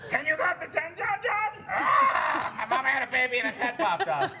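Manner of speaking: high cartoon voice breaking into a yell; Grover-like persona voice. Shown as a demonstration. Comic comparing his act to puppet, high screechy impression. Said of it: "Really similar".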